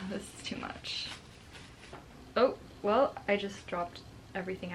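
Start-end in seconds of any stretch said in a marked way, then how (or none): 0.0-1.1 sighing, whispered exasperation; real whispered overwhelm. Real, not acted.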